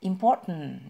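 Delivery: "important" with the second syllable POR stressed, a falling tune; correct stress on important. Shown as a demonstration. Teacher giving the right stress on important to viewers.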